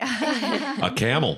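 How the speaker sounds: high, slow, laughing through the words; can barely get it out for laughing. Real, not acted. Pastor cutting in on the camel story, laughing.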